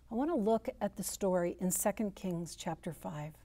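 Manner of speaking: ordinary talk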